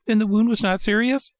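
high anxious puppet voice. A narrator's reading. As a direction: ask it high and eager. Pinocchio eager question.

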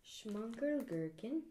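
mock German "Schmunkel Gherkin" with a rising end; German accent. Shown as a demonstration. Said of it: "trying to say it in my authentic, uh, German".

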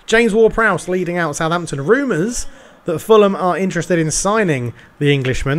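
lightly done play-by-play commentator voice; TV football commentary. Acted. Gamer narrating his FIFA match to viewers, light commentator style. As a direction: call the match like a TV pundit.

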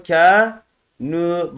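"Kanu" with a long a and long u; lengthened vowels. Shown as a demonstration. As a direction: stretch "Kanu" syllable by syllable. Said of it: "Elif uzatıyor burada".

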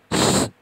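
"স" as a loud, sustained hiss; forceful bare "স", all air; s sound demo. Shown as a demonstration. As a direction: push out a strong unvoiced hiss on "স". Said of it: "এমন হবে".

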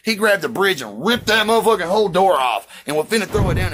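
usual speaking voice

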